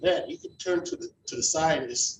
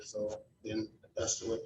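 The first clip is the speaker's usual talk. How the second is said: normal talking voice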